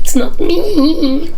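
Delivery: high, very slow whine that fades to nothing; pitiful whine. Acted. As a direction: whimper it out and let it die away. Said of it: "Sad, pitiful whine that tapers into silence".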